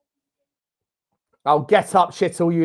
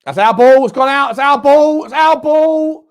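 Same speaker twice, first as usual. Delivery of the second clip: much higher, much louder insisting it's our ball; indignant shout; 10 semitones higher, 12 dB louder, slower than usual. Real, not acted. Fan protesting the throw-in decision to the ref, indignant shout. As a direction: shout the claim at the screen, getting slower.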